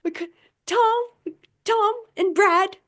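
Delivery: high, stammering fake excuse voice; mock flustered excuse. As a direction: play a flustered host making excuses. Mocking show's flimsy excuse.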